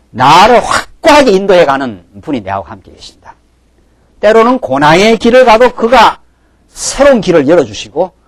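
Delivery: loud, rising preacher's cry; fiery preaching. Acted. Pastor exhorting the congregation, loud fervent preaching. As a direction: drive the point home to the congregation. Preacher building to full force.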